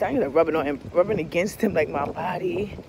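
normal talking voice

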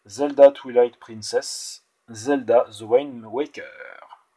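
slow, self-mocking English accent on the titles; a Frenchman hamming an English accent on game titles. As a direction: say the Zelda titles in a put-on English accent, slowly. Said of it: "avec mon superbe accent anglais".

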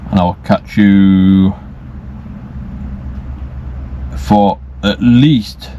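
slow, drowsy, drifting words; nodding off. Real, not acted. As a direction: talk slowly as if dozing off. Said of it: "falling asleep".

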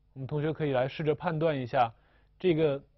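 usual speaking voice

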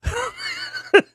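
very high squealing laugh burst; shrieking laugh. Real, not acted. Host cracks up at his cartoon impression, high squeal. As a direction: let out a high cackle at your own joke.

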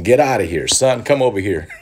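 pretending to order Michigan snow away, playfully bossy; mock command. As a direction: boss the weather around like a pal.

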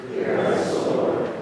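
distant murmured group response; answered softly by the crowd; congregation response. Real, not acted.

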